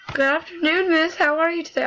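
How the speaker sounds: put-on high-pitched go at an Italian accent; attempted Italian accent. Game player voicing a greeting to a miss, attempted Italian accent. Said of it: "I will attempt to do it".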